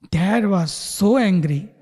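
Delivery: ordinary delivery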